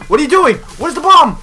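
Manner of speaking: high, loud, urgent questions; alarmed shouting. Real, not acted. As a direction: yell it in sudden alarm. Yelling urgently during play.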